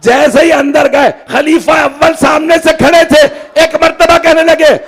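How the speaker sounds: high, loud, ringing pulpit delivery; preaching at full force. Acted. Preacher telling the gathering the hadith, preaching at full force. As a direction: cry the story out to the gathering.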